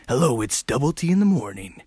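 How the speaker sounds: smooth jazz-station DJ voice; radio show host. Acted. Solo radio host introducing smooth jazz, smooth DJ voice. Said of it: "How do radio show people do this?".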